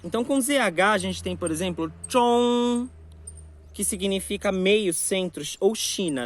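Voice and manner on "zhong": zhong alto, com a língua dobrada para trás; retroflex zhong. Shown as a demonstration. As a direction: dobre a língua e diga zhong mais alto. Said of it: "dobrar a língua pra trás". (zhong retroflexo)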